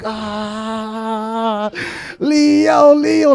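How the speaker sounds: cheering Leo. Retold or imitated. Salvadorans cheering for Leo, excited chant. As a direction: cheer him on like a proud fan.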